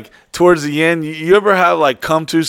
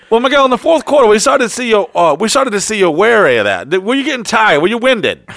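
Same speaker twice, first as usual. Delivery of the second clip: high, loud broadcast interviewer; sports reporter; 8 semitones higher, 5 dB louder than usual. Retold or imitated. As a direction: ask like a sideline reporter. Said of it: "interview like Sports Center".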